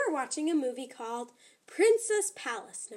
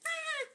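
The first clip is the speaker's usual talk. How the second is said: short high squeal of fright; high scream; 5 dB quieter than usual. Acted. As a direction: squeak out a scared kitten scream. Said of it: "they screamed".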